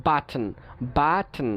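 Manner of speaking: বাটন with the t sounded before n; T pronounced. Shown as a demonstration. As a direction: keep the t in বাটন. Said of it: "বাদ না দিয়েও আমরা উচ্চারণ করতে পারি".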